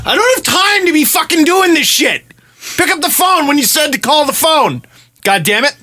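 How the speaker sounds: high-pitched frustrated venting; retold fury at an unanswered call; exasperated rant. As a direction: vent like you're fed up waiting by the phone. Retelling his frustration waiting.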